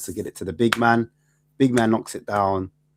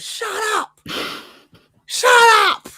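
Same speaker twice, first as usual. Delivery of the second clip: very high, loud shouting fan impression; angry fan; 9 dB louder than usual. Fan turning round on the others, loud high shout. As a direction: bellow it like a furious fan in the stands. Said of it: "he goes".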